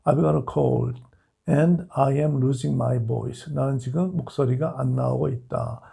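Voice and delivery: normal talking voice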